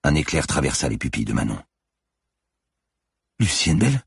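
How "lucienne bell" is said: higher voice rising at the end; confused, slowly recognising; bewildered question. A narrator's reading. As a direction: repeat the name as a puzzled question. Manon comes back to herself, questioning.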